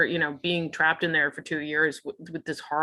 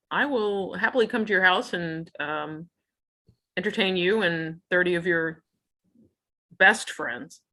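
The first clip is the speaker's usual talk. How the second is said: ordinary talk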